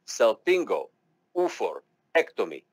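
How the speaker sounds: Salpingo-oophorectomy said slowly, syllable by syllable; teacher modelling Salpingo-oophorectomy slowly; slow demo. Shown as a demonstration. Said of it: "slowly but surely".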